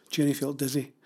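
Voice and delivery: usual speaking voice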